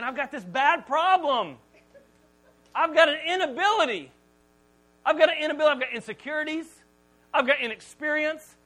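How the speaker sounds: high, loud, mounting self-doubt; distressed complaint. Acted. Moses listing his failings to God, high anguished complaint.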